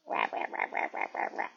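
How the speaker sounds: low gravelly rah rah squawk mimicking the bird; imitating her pet's squawk. Showing viewers how her pet talks to her, mock squawking rahs. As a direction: copy his grumbly rah sounds. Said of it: "This is how he talks to me".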